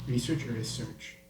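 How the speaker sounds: "research" said twice, first REsearch then reSEARCH; pronunciation contrast. Shown as a demonstration. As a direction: contrast both stresses of "research", first then second syllable. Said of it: "is it research".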